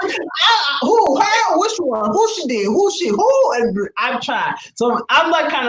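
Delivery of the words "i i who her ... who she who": high rapid heated questions; jumping in to find the culprit; fired-up mom. Retold or imitated. Mom re-enacting grilling her daughter about the bully, high. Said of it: "replaying her reaction to the kids".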